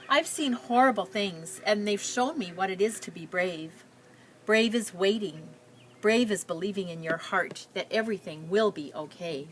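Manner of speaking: put-on reading a picture book aloud to children; story narration. A narrator's reading. Teacher reading a refugee girl's story to her class, storytelling. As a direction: tell it gently, like reading to a class.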